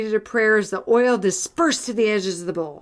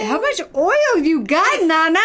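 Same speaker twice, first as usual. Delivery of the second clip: high, loud mock amazement; loud, high teasing question; joking call-out; 8 semitones higher, 5 dB louder than usual. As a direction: holler it at grandma in playful disbelief. Calling out jokingly to absent grandmother.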